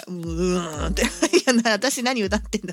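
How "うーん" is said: deep drawn-out "uun"; low-voiced groaning "uun" to show a singing style; very low "uun" demo. Shown as a demonstration. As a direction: drop right down into a very low voice. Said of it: "すんごい低い声".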